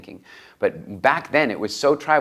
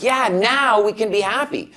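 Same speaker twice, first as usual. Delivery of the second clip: mock cheer, much higher, louder and slow; mock relief; 13 semitones higher, 4 dB louder, slower than usual. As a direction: parody a sigh of relief that comes too late. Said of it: "and we're like".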